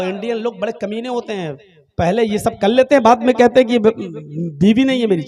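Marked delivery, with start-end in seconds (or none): none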